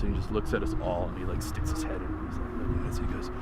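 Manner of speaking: low, hushed and tense; hushed storytelling. Real, not acted. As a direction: drop to a near whisper for suspense. Building suspense in the story.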